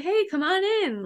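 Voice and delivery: high, friendly retold greeting; familiar welcome. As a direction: greet like an old friend, pitched well up. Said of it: "Like, he just knew him".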